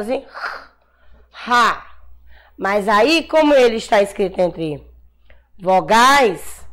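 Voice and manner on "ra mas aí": ordinary talk, nothing marked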